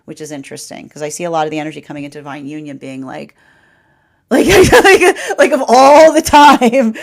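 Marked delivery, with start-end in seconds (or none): 4.3-7.0 laughing. Real, not acted. Reader laughs at the absurd timing.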